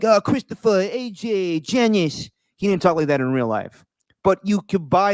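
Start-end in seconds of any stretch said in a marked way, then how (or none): 0.0-2.3 high, put-on New Jersey mobster; mock a TV mob boss calling out names; imitation mob boss, pitched up; Tony Soprano voice.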